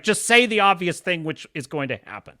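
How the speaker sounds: normal talking voice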